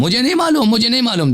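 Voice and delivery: emphatic dismissive retort. Real, not acted. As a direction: push back sharply, voice jumping up. Heated debate over a narration.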